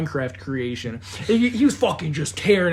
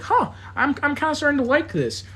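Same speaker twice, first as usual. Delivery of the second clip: musing to himself; 8 semitones higher than usual. Retold or imitated.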